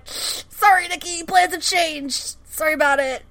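high-pitched, flippant sorry; mock apology. Mohinder abandons Nikki for new plans, mock apologetic. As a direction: toss off an insincere apology in a high voice.

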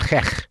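hech with a throaty Yiddish ch; Yiddish throat sound. Shown as a demonstration. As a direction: rasp out hech from the back of the throat. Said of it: "That's just the Yiddish sound".

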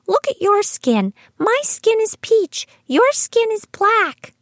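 mock a child lecturing grandpa, slowing at the end; high, earnest child voice pointing things out; little girl. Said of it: "She said to Ted one day".

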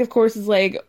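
ordinary talk, nothing marked

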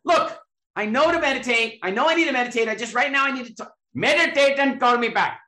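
high, loud, indignant mock rant; exasperated shout. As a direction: rant at a friend who won't listen, hammed up. [name] exasperated at Deepak.

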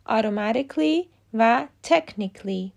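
automatically and technically ending in "-ikly"; correct endings. Shown as a demonstration. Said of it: "و به همین ترتیب". (تلفظ درست automatically و technically با «ایکلی»)

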